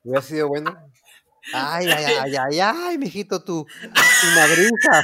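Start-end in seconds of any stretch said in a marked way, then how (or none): 1.5-5.0 loud, slow, drawn-out mock lament; hammed-up pitying cry, louder; mock pitying wail. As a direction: wail it like a mocking aunt. Mock pity over a beating.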